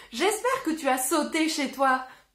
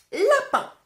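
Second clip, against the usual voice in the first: lapin sung out high and clear; teacher showing the word lapin; announced word; 10 semitones higher, 4 dB louder than usual. Shown as a demonstration. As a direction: present the word lapin brightly to the class. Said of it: "Je vais choisir le mot".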